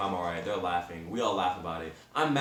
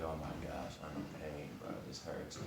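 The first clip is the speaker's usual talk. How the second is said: much softer, lower mock moan of pain; pained muttering; 6 semitones lower, 14 dB quieter than usual. Injured rider in the back seat, quiet pained mutter. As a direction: groan it low under your breath.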